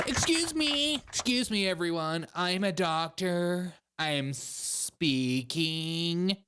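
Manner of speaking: high indignant start, settling into slow pomposity; hammed-up pompous interrupter; self-important doctor. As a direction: demand attention, then stretch out every word.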